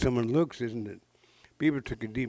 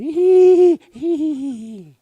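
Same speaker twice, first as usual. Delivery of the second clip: loud squealing high laugh; giddy high laugh; 13 dB louder than usual. Acted. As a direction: burst into a squealing giggle. Muskrat laughs about marriage.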